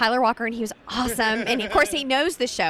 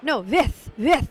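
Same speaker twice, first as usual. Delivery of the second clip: "with" said as "vith", a German v sound; slower than usual. Retold or imitated. Directors correcting her, German vith with a v. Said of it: "They're like".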